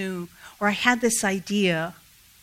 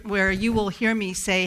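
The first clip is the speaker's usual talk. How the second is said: ordinary talk, nothing marked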